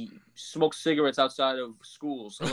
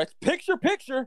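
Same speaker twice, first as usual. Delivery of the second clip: JR announcer impression; 10 semitones higher than usual. Mocking the broadcast's picture-in-picture call. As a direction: boom out picture like a wrestling commentator. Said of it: "You gotta say it like J R".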